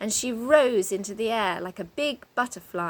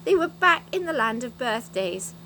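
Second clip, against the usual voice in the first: ordinary delivery, nothing marked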